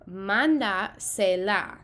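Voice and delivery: "Mándasela" said slowly with stress on "Mán"; slow teaching demo. Shown as a demonstration. Teacher modelling the command for students, slow and stressed. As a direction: spell it out slowly, hitting the first syllable "Mán". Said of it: "we're going to preserve our original stress".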